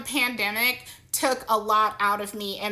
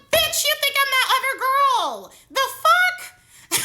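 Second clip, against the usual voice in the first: high, louder, laughing in disbelief; incredulous laughing outburst; 13 semitones higher, 5 dB louder than usual. Retold or imitated. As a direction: shriek it out through laughter. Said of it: "I'm like".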